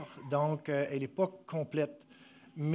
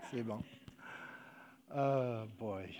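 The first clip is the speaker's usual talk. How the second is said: soft, low words after a small laugh; collect yourself quietly, real emotion underneath; regaining composure; 7 semitones lower, 12 dB quieter than usual. Real, not acted. Preacher steadying himself before the congregation, low and overcome. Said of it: "je suis en train de perdre le contrôle".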